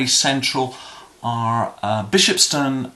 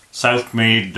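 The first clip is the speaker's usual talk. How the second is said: broad Southmead Bristol accent. Shown as a demonstration. Guide shows how Southmead locals say their district, broad Bristol accent. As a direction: drop into a thick North Bristol accent for Southmead. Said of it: "they tend to speak a bit like this".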